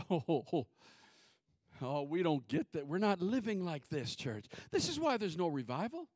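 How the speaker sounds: ordinary talk, nothing marked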